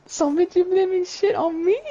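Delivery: high squeaky mock outrage; mock indignant friend. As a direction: squeal the accusation like an outraged buddy. Friend accused of blaming, voiced.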